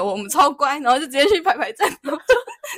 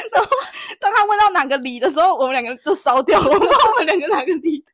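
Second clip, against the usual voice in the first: words broken by real laughter, higher and louder; laughing while telling; 8 semitones higher, 3 dB louder than usual. Real, not acted. Friend recalls not knowing her own neighbourhood, laughing. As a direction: giggle through the embarrassing bit. Laughs retelling awkward moment.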